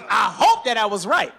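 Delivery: ordinary talk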